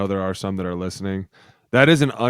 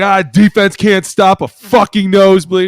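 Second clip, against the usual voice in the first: loud mocking shout about the defense; hammed-up locker room gripe; mock rant; 9 semitones higher, 10 dB louder than usual. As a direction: yell it like a fed-up teammate. Player ranting in locker room.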